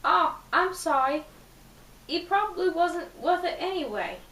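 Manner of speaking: Charlie McDonald. Acted. Boy consoles girl after breakup, in a British accent. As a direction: sympathise in a put-on British accent. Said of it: "said in a smooth British accent".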